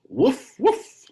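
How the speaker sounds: high playful woof woof; dog bark. Acted. Dog barks in picture book.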